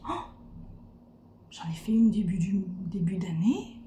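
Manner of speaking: hushed whisper to herself. Retold or imitated. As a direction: whisper the realization. Sudden realization, hushed aside.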